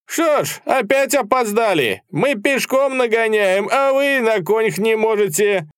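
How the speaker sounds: high, loud, drawn-out taunting; excited jeering shout. A narrator's reading. Flushed officer taunting Cossacks who came late, excited shouting. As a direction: holler the reproach in long high cries. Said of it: "говорил офицер с красным, возбуждённым бегом лицом".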